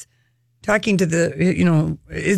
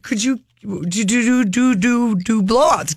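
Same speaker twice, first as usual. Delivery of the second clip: stuttering nervously. Retold or imitated. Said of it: "'Cause now I'm stuttering".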